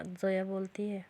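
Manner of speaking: ordinary delivery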